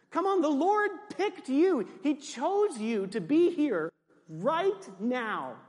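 high, emphatic preacher's cry; rousing exhortation. Real, not acted. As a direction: rouse the crowd, drawing out the last words.

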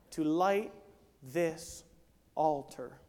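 loud, high, driving sermon delivery; fiery preacher building to a climax; full-force preaching. Acted. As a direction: preach it out to the whole room.

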